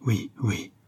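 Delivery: exhausted, effortful assent; mildly acted last-ditch effort; straining with effort. A narrator's reading. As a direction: force the words out, worn out. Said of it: "s'écria Yvena en faisant un suprême effort".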